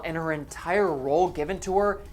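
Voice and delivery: ordinary talk, nothing marked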